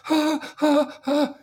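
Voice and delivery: high, loud panicked gasping cries; nightmare gasps. Retold or imitated. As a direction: wake from a nightmare, gasping in terror. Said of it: "you wake up in a nightmare going".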